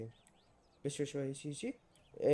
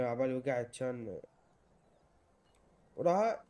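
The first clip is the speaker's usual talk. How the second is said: ordinary delivery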